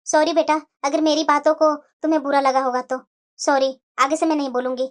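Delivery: put-on remorseful elderly mother; apologetic mother. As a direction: make peace with her daughter.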